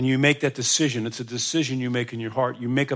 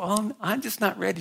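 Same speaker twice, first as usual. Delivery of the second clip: polite refusal; 7 semitones higher, 3 dB quieter than usual. Retold or imitated. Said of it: "She's really polite".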